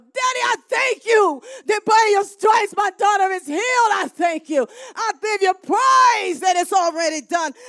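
loud, high prayer of thanks; crying out in prayer. Shown as a demonstration. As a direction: pray it out loud with full force. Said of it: "You just cry out".

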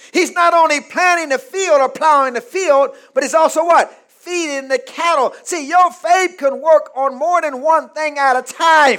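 high, punchy sermon delivery; full-force preaching. Real, not acted. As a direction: preach it out to the congregation.

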